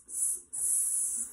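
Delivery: soft hissing like a snake. Acted. For the kids, hissing like a snake. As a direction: hiss softly like a snake.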